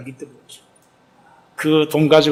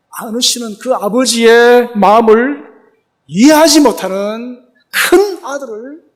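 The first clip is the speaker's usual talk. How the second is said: louder, higher fervent sermon climax; preaching at full force; 7 semitones higher, 9 dB louder than usual. Acted.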